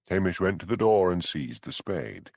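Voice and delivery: ordinary delivery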